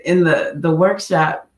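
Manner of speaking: normal talking voice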